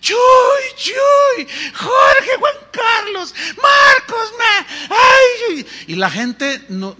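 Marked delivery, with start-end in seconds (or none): 0.0-5.7 high-pitched, loud mock crowd screams; imitating screaming fans. Preacher mimicking fans mobbing the arriving preachers, screaming. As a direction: shriek out names like a frenzied crowd. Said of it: "La gente empezaba a gritar y decía".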